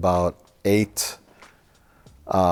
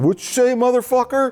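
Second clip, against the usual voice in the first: much higher, louder imitated street challenge; mock tough guy; 4 dB louder than usual. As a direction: caricature of a guy squaring up, hammed-up. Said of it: "someone comes down and goes".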